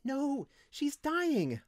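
high, slow, anguished wail. A narrator's reading. Windling pleading for the dying slave, wailing. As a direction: stretch the words into a high wail. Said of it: "Linthus wailed".

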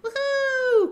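very high, gleeful little penguin whoop; Pip-Pip's joyful cry. Acted. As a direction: whoop for joy as a tiny penguin. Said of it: "cried little Pip-Pip".

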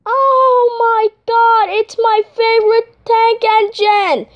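high, slow, gushing fan voice; starstruck excitement. Pretend. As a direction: squeal it out like a thrilled fan. Operator thrilled Thomas is calling.

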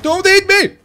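high, loud yell of protest; sharp, high-pitched command; alarmed shout. Real, not acted. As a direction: shout it at a looming beast. Same plea, now yelled.

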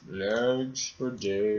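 slow drawl. Pretend. Drawn-out joke phrase.

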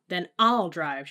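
loud, drawn-out angry cry; screamed. A narrator's reading. Mother seizing the wheel, loud defiant cry. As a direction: shout it slowly in defiance. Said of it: "she screamed".

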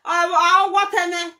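high shocked cry, hammed up; hammed-up shocked wife crying out; mock shocked wail. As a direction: act out the wife's shocked wail. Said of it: "that Esther come say".

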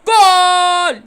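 loud goal yell by accident; mistaken goal shout. Real, not acted. Kid shouting goal by mistake, then apologising, loud. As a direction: blurt out a loud goal cry. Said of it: "yanlışlıkla bağırdım".

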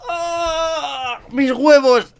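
yelp of pain. Acted. Cat claws him, mock pain.

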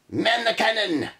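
high shouted pirate order; pirate yell. Acted. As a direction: bellow it like a pirate captain. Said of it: "yells Red Eye".

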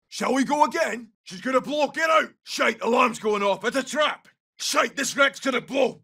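high-pitched alarmed shouting; urgent danger warning. Acted. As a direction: yell to the squad to get clear.

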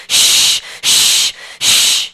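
imitated hiss, pause between bursts; long hissing shh. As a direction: repeat a strong, drawn-out shh. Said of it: "all I hear is shh".

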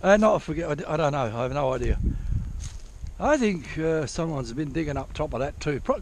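usual speaking voice

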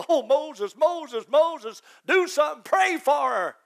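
desperate brother pleading with Moses, loud and high; panicked plea. Acted. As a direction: cry out to Moses in alarm. Aaron begs Moses in panic.

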